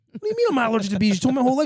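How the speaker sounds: can't believe what he hears; disbelief. Retold or imitated. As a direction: son shocked by his dad. Shocked protest to dad.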